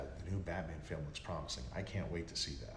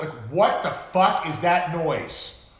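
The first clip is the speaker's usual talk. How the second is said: loud, slow, higher, incredulous; exasperated rant; 8 semitones higher, 14 dB louder, slower than usual. Real, not acted. Host ranting about Batman Forever music, loud and exasperated. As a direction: stretch it out in disbelief.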